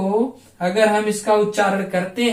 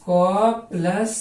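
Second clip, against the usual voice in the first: क plus said very slowly, syllable by syllable; slow breakdown; much slower than usual. Shown as a demonstration. Teacher slowly splitting the syllable for students. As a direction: spell out क plus slowly. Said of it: "इसमें से".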